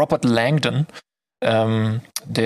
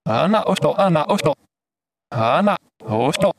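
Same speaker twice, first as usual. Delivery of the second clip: played backwards; 4 semitones higher than usual. Shown as a demonstration. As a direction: recite the names in reverse order. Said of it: "rückwärts abgespielt".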